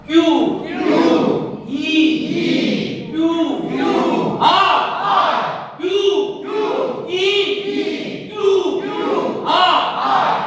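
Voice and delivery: letters barked out in short loud bursts; vocal drill. Shown as a demonstration. Teacher leads the class through a loud letter-drill warm-up. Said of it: "பார்க்கலாமா?".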